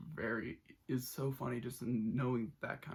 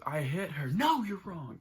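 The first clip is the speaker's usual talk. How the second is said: loud and much higher, a put-on movie voice; hammed-up yelled movie quote climbing higher; mock outburst; 5 semitones higher, 7 dB louder than usual. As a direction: blurt it out like the unhinged lead. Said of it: "and then goes".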